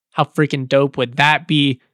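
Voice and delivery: normal talking voice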